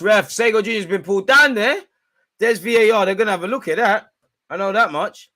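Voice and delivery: high, loud indignant shout; loud ref appeal. Real, not acted. Fan shouting a foul claim at the ref, loud and high. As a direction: yell up at the ref in outrage.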